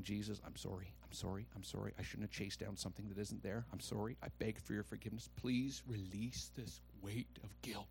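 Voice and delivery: hushed, low, pleading prayer; under the breath. Retold or imitated. Preacher retelling his guilty prayer to Jesus, under the breath. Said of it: "under my breath, I'm going".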